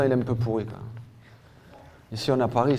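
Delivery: usual speaking voice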